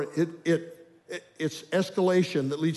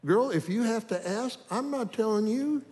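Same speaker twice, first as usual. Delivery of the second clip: much higher, mock-sassy brush-off with a laugh; sassy inner retort; 7 semitones higher than usual. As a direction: dare her to guess. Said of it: "And I thought".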